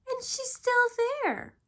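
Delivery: very high, loud cub voice; frightened Baby Bear. A narrator's reading. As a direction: squeal it like a scared little cub. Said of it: "stuttered Baby Bear".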